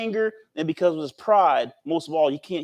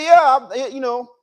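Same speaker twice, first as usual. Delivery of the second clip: acted crazy mad; 6 semitones higher, 8 dB louder than usual. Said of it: "he's on a crazy mad".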